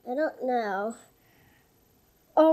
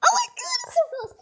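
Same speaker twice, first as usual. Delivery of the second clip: frightened shriek; 6 dB louder than usual. Real, not acted. Bird sneaks up, she panics.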